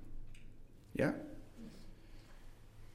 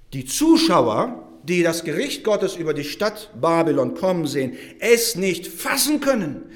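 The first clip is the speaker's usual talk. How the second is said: raised, louder preaching voice; forceful preaching; 4 semitones higher, 6 dB louder than usual. Real, not acted. Preacher telling congregation of Babylon's sudden fall, forceful preaching. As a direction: drive home the shock with heavy emphasis.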